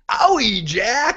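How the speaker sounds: high whiny wince; mocking Heller. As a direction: squeak it out like a baby.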